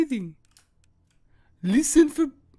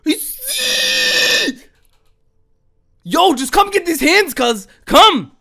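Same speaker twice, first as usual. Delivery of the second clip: loud, higher defiant challenge; defiant shout; 9 semitones higher, 8 dB louder, much slower than usual. Real, not acted. Scared gamer taunting the monster, loud defiant shouting. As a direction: dare the monster to come at you.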